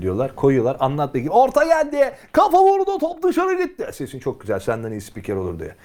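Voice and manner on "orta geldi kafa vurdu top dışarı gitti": high, loud parroted match call; mocking football commentator. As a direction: ape a shouting commentator. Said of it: "papağan işi bu".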